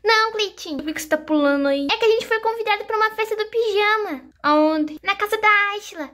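put-on high kid chatter; lightly voiced child. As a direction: chatter like an excited little girl. Dolls talk among themselves.

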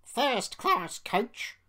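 high, slow snooty grumble; drawn-out disdain from a haughty coach; grumbling. A narrator's reading. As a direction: complain loudly and high, word by word. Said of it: "would grumble".

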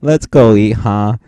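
ordinary talk, nothing marked

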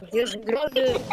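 put-on deep voice. Boy keeps insisting he is big, put-on deep voice. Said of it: "Dejá de hacer la voz".

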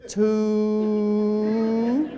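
one word stretched out long; painfully slow. Acted. Caller writing down agent's number, painfully drawn out. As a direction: draw TWO out endlessly, as if writing it. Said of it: "you go like this".

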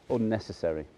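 ordinary talk, nothing marked

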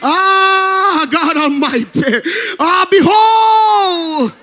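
sing-shout the psalm like a climax at the pulpit; impassioned pleading preacher, straining high; preaching at full force. Acted.

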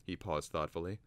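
usual speaking voice

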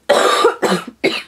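a short dry cough mid-sentence; a real coughing fit starting. Real, not acted. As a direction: cough once, then carry on. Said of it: "see, just like that".

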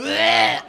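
loud comic "bleh" of disgust; exaggerated retch; retching noise. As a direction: act out gagging noisily. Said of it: "I was sitting there going".

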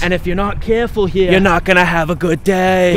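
lightly put-on Southern hunter accent; a mild Southern outdoorsman accent, slowed. Vlogger introduces the swamp hunt in a mild Southern accent. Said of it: "you do the accent better than me".

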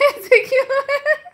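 हँसते हुए, ऊँची आवाज़ में; हँसी रोकते हुए शिकायत; laughing. Real, not acted. Bhabhi laughing over husband fight. (हँसते हुए भैया की शिकायत)

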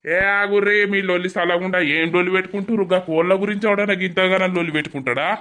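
loud, high, fast scolding over the quarrel; shouting to stop a fight. Acted. Neighbor shouting at two quarreling women to stop. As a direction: yell at them to cut it out.

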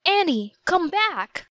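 high, desperate call into the dark; a boy calling out for his little sister. A narrator's reading. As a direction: call after her as she vanishes. Jack calling after vanished Annie.

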